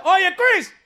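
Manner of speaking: high, bright dad calling. Retold or imitated. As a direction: call to your son across the school. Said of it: "My dad's like".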